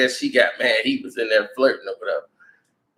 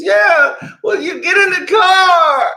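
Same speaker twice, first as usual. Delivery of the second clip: high, loud, stretched-out demand; imitated yelling; 6 dB louder, slower than usual. Girl ordering her into the car, high drawn-out yell. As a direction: holler it in a mock-female falsetto.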